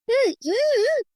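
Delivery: high, muffled whimpers through a covered mouth; scared hums, mouth held shut; muffled frightened moans. A narrator's reading. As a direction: moan in fear behind a hand. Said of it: "பயத்துடன் முனகினாள்".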